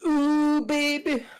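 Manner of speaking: falsetto. Acted. Excited reaction before going in.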